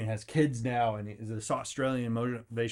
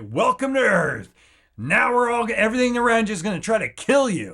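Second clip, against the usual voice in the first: mock booming welcome; 8 semitones higher, 7 dB louder than usual. Life welcoming a newborn, hammed-up loud. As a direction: announce doom like a grim host.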